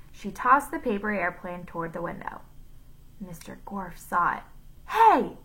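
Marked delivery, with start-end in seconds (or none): 4.9-5.4 high, loud sudden "Hey!"; shouted. A narrator's reading. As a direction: shout to stop someone. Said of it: "he shouted".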